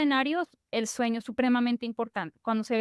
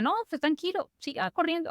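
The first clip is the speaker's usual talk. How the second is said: lightly voiced, higher and carefree; breezy doctor reassurance; 6 semitones higher than usual. Another doctor waving off an older runner, light carefree reassurance. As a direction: brush off the worry like a too-relaxed doctor. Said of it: "pues, le diga".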